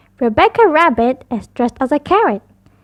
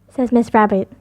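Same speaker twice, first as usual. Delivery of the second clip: ordinary delivery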